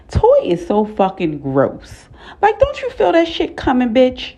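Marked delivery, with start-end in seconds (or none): 2.4-4.4 disgusted. Real, not acted. Disgusted rant about the sneeze.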